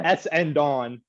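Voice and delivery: S and Don said slowly, every part spelt out: S, and, Don; overpronounced. Shown as a demonstration. Co-host overpronouncing a team name he got bullied for, S and Don slowly spelt out. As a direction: spell out S and Don, each part slow and apart. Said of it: "extremely pronouncing the S".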